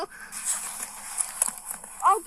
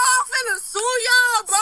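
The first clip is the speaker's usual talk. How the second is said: louder sobbing words; crying; 4 dB louder than usual. Real, not acted. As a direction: cry out through tears. Crying while being arrested.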